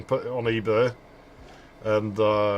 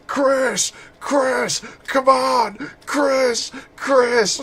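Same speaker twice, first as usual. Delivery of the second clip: high mock yells of Chris from the game heroine; imitating Sheva yelling; 12 semitones higher, 5 dB louder, much slower than usual. Annoyed partner yelling for Chris to come back. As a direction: call out Chris much higher and louder, hammed-up. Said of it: "She yells that at night".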